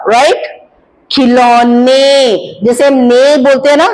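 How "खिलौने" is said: खिलौने with a long, held ने and no nasal sound; slow clear ने. Shown as a demonstration. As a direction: hold the ने of खिलौने long and oral. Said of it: "बिंदी होती तो ने आना था". (खिलौने में ने लंबा, बिना बिंदी)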